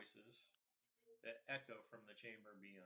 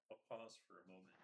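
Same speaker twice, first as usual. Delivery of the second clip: ordinary talk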